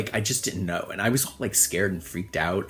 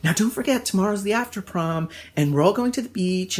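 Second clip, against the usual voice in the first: put-on higher, eager girlish voice; date's eager reminder; 10 semitones higher, 3 dB louder than usual. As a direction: voice her chirpy reminder up high.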